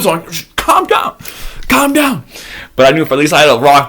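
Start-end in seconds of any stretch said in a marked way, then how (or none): 0.5-2.2 much higher shaky calm down; high frantic self-calming. Retold or imitated. Scared hiker telling himself to calm down, high pitched. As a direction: tell yourself to calm, failing.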